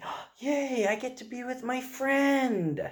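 put-on light up like a toddler hearing good news; excited little kid. Dad imitating his son thrilled about a day with Mommy, high and excited. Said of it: "And he'd get excited and say".

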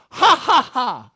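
loud, high ha-ha burst; acted divine laughter. God in heaven laughing, loud high laughter. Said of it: "what is God doing".

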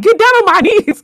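high, loud mock-startled echo; hammed-up high-pitched imitation; Chucky. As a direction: squeal it back as a stunned question. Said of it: "He was like".

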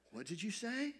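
softly taken aback, as the startled rabbi; hushed, stunned query; shocked. Retold or imitated. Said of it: "just kind of shocked for a moment".

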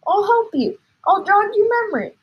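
high, loud offer of help; eager cry. A narrator's reading. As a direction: jump in excitedly. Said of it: "cried Mister Cranky".

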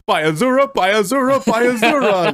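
high, gushing fan impression; adoring fan. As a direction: imitate an overawed fanboy. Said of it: "the adoring fan".

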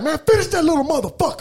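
yelling at son. Retold or imitated. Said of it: "I be like".

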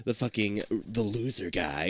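whispered aside, mocking the role. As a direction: hush it like a secret gripe. Quoting Dean's inner complaint.

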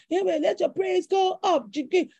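playful mock of a church hype man; mock hype worship leader. Mocking a dancing worship leader.

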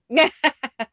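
high burst of laughter. Real, not acted. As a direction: laugh out loud at the joke. Laughs at co-host's union break joke.

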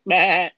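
short nasal meh like a sheep; sheep bleat. Pretend. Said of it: "make your best sheep impression".

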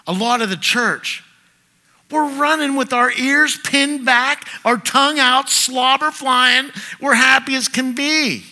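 loud, high revival preaching; fiery preaching. Acted. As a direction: preach it big and loud, like a dog running wild. Preaching at full force.

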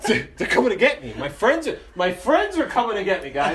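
high, loud mock-hopeful announcing; retold deluded hope, laughing slightly; drunken insistence. Drunk self insists friends will come.

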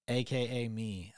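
ordinary talk

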